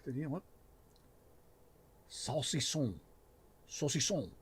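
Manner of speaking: hammed-up, really bad French accent; playful mock-French voice. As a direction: badly fake a French accent. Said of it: "Really bad French accent".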